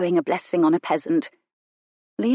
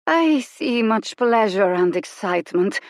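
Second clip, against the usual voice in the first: purring; 4 semitones higher, slower than usual. A narrator's reading. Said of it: "almost a purr".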